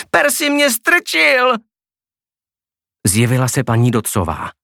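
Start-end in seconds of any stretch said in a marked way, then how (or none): 0.1-1.6 much higher, drawn-out wail of accusation; shrill cry of blame. A narrator's reading. Girl sitting in the fountain accuses Percy, wailing slowly. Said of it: "a křičela".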